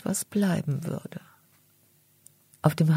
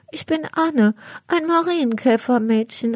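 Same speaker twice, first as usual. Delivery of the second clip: put-on much higher, small bright girl's voice; little ladybird girl; 6 semitones higher than usual. Ladybird girl answering the cat: high little girl voice. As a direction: sound like a tiny beetle introducing herself. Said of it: "antwortet das kleine Käferchen".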